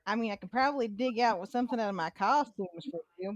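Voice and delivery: ordinary talk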